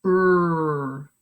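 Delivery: "Brr" drawn out as a long rolled sound; stretched sound. Shown as a demonstration. Teacher stretches the blend for kids to hear, drawn out. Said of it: "Let's stretch that with me".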